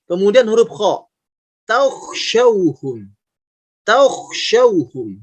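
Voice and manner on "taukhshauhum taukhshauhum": slow "taukhshauhum" with dark vowel before a rasped kh; thick kho. Shown as a demonstration. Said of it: "tapi taukh". ("taukhshauhum" pelan, "taukh" tebal)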